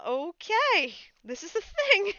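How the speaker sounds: high, loud, giddy okay; excited acceptance. Retold or imitated. New mod accepting the role, high excited glee. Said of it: "And I just went".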